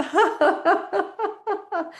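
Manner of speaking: high, climbing laughter; shaking laugh. Shown as a demonstration. Shaking up the drink, high laughter. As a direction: shake out a high run of laughs. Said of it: "shake it up".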